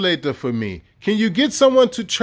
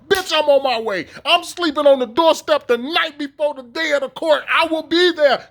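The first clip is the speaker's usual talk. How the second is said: defiant rant; 7 semitones higher than usual. Real, not acted.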